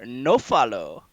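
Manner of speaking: finger-wagging order in character; Walder scolding. Acted. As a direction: forbid it firmly, pitch rising then dropping. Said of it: "and he wags his finger".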